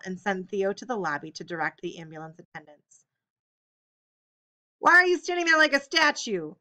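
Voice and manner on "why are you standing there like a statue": loud, high angry scolding; shouted at son. A narrator's reading. Father yelling at his son to move, angry. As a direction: snap it at a frozen son. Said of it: "Hu shouted at his son".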